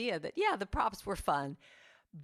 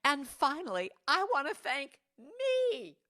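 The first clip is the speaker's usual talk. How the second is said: higher, louder, slow proud quote; quoting the actress; 8 semitones higher, 5 dB louder than usual. Retold or imitated. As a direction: savor it like an award speech. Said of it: "She said".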